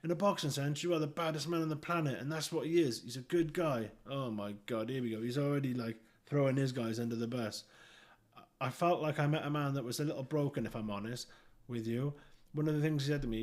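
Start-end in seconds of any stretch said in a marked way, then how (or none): none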